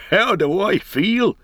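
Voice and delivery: high, slow joyful cry. A narrator's reading. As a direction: burst out with wonder. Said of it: "he cried".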